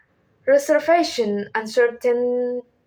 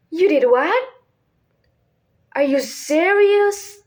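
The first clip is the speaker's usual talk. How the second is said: high, louder, amazed questions; shocked disbelief; 6 semitones higher, 4 dB louder than usual. Shown as a demonstration. Teacher giving lines to try saying, loud shocked disbelief. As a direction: raise it sharply in mock shock. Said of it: "strong feelings such as shock".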